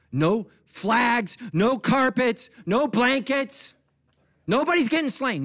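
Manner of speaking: high, loud, hammered list; rising preacher's shout. Real, not acted. Preacher promising church a simple prayer time, shouting. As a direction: belt out each item to the room.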